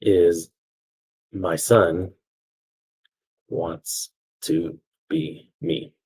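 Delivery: very slow, word by word; long pauses as if writing it down; dictating. Real, not acted. Teacher writing out the story's conclusion, slow dictation.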